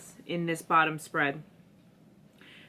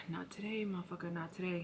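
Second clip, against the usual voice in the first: muttered curse; 12 dB quieter, faster than usual. Real, not acted. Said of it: "Excuse me, sorry".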